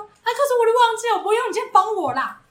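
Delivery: high-pitched imitation of a helpless boss calling; retold calling out. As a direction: call out for help, play-acting the boss. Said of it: "主管就在里面喊说". (模仿主管在里面喊人帮忙)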